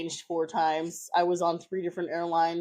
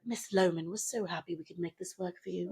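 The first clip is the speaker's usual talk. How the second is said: put-on British accent; 7 dB quieter than usual. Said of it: "the lady was British".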